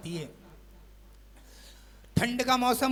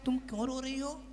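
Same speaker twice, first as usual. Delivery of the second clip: softer, gentle question to a crying wife; soft husband's concern; 3 semitones higher, 10 dB quieter, faster than usual. Acted. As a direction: ask tenderly, as a worried husband.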